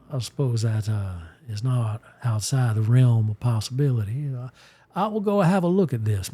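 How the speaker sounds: Ransom Bash greeting the psychic, lightly Southern; a friendly Mississippi gent addressing ma'am; Mississippi accent. Acted. Character offers the psychic tarot readings, in a Mississippi accent. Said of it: "I'm just talking kinda like the way he talks now".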